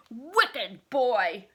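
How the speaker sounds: loud furious outburst; angry cry. Acted. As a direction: shout at the wicked boy. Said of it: "she cried".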